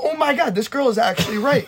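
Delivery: high, exaggerated astonishment; mock amazement. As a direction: play up the shocked discovery.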